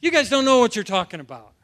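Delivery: mildly angry mock retort; lightly acted scornful outburst; firing back, acted. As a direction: fire back like an affronted official. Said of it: "they're just firing back".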